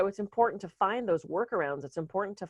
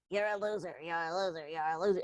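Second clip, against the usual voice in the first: softer, squeaky cartoon-mouse take on the put-down; silly cartoon voice; 6 dB quieter than usual. Mocking the inner critic in a soft cartoon mouse voice. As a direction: make the insult sound ridiculous and tiny. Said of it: "make its voice a Mickey Mouse voice".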